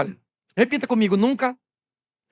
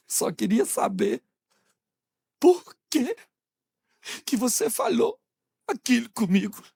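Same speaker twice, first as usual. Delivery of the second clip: mock crying; 2 semitones higher than usual. Wife crying after the quarrel, mock-tearful. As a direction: play up the weepy, broken question. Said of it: "ela começa a chorar depois".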